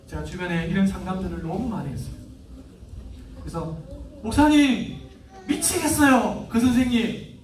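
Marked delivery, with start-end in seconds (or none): 4.2-7.4 loud, higher frantic cry to the pastor; mock desperation, nearly yelling; exasperated shout. Distraught parent crying out to the pastor, loud and exasperated.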